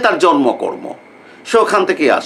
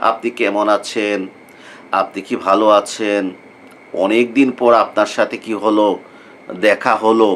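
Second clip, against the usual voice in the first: formal standard Bangla; 9 semitones lower than usual. Retold or imitated. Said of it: "একেবারে শুদ্ধ বাংলায় কি করলাম, কথা বলা শুরু করলাম".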